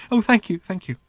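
high, breathless robot in raptures; giddy little robot. Acted. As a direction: gush like a robot besotted with everything. Robot thanking Ford.

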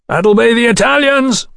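high-pitched call over a racket; shouting. A narrator's reading. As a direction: call out above the noise, voice pitched right up. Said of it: "Godwin shouted".

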